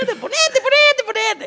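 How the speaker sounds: very high, rapid-repeat pleading in a joke; urgent falsetto urging. Acted. Joke doctor hurrying his patient onto the table, high-pitched urging. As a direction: push the patient along in a squeaky high voice.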